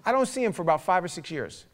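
ordinary delivery

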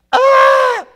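high, very loud scream; sudden piercing yell of shock; acted scream. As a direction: scream as if your hand has seized. King's hand withers.

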